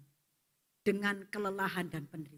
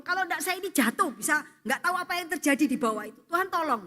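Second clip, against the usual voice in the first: desperate plea; 6 semitones higher, 5 dB louder, faster than usual. Acted. Desperate prayer while hanging.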